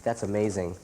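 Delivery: normal talking voice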